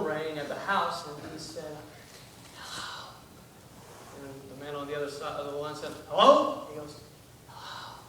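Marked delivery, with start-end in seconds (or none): 2.6-3.1 hushed, voiceless phone answer; child's whisper. Acted. Kid answers the phone to a stranger, whispering. 6.1-6.7 high, loud, rising phone hello; puzzled loud hello. Acted. Man on the line calls out. 7.5-8.0 hushed, voiceless phone answer; child's whisper. Acted. Kid answers the phone to a stranger, whispering.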